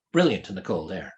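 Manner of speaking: ordinary delivery, nothing marked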